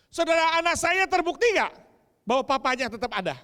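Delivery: high, loud fiery preaching; preaching at full force. Acted. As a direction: proclaim it to the congregation with full passion. Preaches loudly again. (khotbah berapi-api dengan suara lantang)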